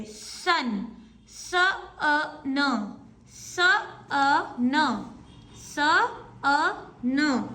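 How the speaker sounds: "स, अ, न" split into separate sounds; ध्वनि अलग-अलग बोलना; sounding out. Shown as a demonstration. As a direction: break "सन" into स, अ, न one by one. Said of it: "आप सन फाइव टाइम्स बोलिए".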